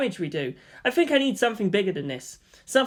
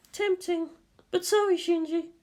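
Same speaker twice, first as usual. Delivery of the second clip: sleepy Misato; 12 semitones higher than usual. Acted. Misato pleads with Shinji to let her sleep, high drowsy voice. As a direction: beg to be left asleep.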